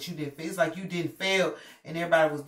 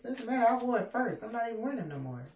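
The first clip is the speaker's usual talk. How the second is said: sassy comeback; 6 semitones higher than usual. Acted. Sassy retort.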